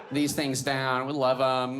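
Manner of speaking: ordinary talk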